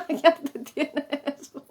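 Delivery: breaking up into high laughter; real laughing. Real, not acted. Teacher amused by her choni demonstration, really laughing. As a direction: talk through a burst of giggles. Teacher cracks up at own demo.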